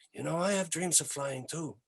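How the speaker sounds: put-on a shy confession in a soft Québécois accent. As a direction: drop your voice and keep the Quebec accent. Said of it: "I'm gonna use an accent".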